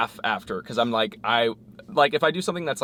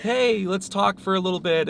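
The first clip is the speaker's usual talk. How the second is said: awkward party opener; 9 semitones higher than usual. Retold or imitated. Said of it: "and just be like".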